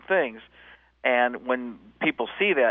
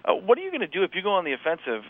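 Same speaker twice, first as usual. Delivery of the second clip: ordinary talk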